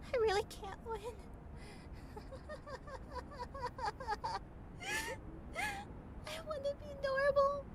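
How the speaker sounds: crying. Acted. Alone after a bad day.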